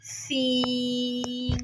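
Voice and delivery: सीन held very long with a hiss; long hissing seen. Shown as a demonstration. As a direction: draw out सीन, whistling. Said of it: "मज़ीद लंबा करेंगे". (सीन लंबा, सीटी के साथ)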